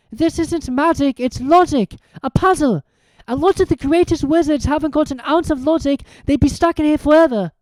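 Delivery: high, quick schoolgirl explaining; eager Hermione. A narrator's reading. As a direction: rattle off the logic with excitement.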